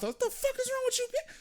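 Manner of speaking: very high-pitched retold exasperation; retold outburst. Man retelling his reaction to her screaming, outraged. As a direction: burst out in mock outrage, pitched right up.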